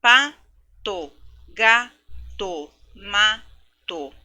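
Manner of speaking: teacher hitting the rhyme in each word; rhyme emphasis. Shown as a demonstration. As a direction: punch the rhymes in "Pato, gato, mato". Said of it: "perceber as rimas nas palavras".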